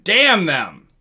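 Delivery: usual speaking voice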